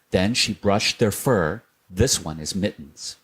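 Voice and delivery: ordinary delivery, nothing marked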